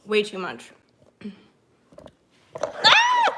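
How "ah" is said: short, very high, loud shriek; real high-pitched yelp; startled scream. Real, not acted. Cries out at a mishap.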